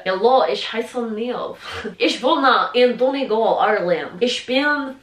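slow German with a mock Spanish-Russian accent. Shown as a demonstration. Showing viewers her school German, in a mock Spanish-Russian accent. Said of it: "I'm speaking German in a Spanish slash Russian accent".

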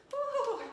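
high loud whoop; excited whoop. Real, not acted. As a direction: let out a big celebratory woo. Cheer after hard set.

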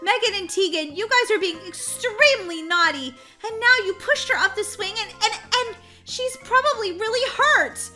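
high, loud, upset telling-off; agitated babysitter reprimand; flustered scolding. Acted. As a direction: scold the naughty kids, rattled and rising. Sitter scolds after the push.

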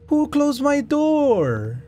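high, drawn-out startled question; startled protest. Real, not acted. Player finds the door shut, slow high alarmed cry. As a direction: stretch it out in alarm.

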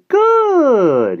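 put-on the little man delighted that the boy agreed; enthusiastic exclamation. A narrator's reading. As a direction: shout it with delight. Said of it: "he exclaimed enthusiastically".

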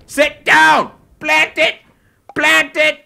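high, loud orders barked at a pet; excited game commands. Real, not acted. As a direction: call the command out sharply.

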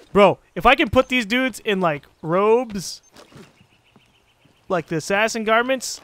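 ordinary talk, nothing marked

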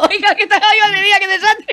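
high-pitched, helpless laughter through the words; laughing. Real, not acted. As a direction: crack up while lamenting the blunder. Cracking up over the blunder. (muerto de risa, con voz aguda)

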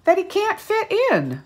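slow rising read, climbing high; slow and loud, rising to a high peak; building storybook suspense. A narrator's reading.

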